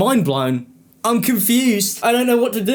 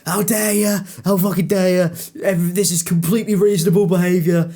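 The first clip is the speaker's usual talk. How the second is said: indignant put-on voice, a little higher; mock outrage, higher and accented; mock angry commenter; 4 semitones higher than usual. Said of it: "people going".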